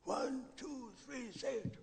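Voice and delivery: imitating the uncle's ultimatum; menacing countdown.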